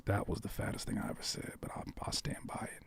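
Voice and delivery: soft whispered aside. Real, not acted. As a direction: whisper it as a private confession. Whispered aside about brownies.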